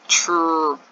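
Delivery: "true" as a drawn-out "trrroo" blend; blended phonics sound. Shown as a demonstration. Teacher showing how T and R go together, blended phonics sound. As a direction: stretch "true" as one joined T-R sound. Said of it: "T and R go together to say".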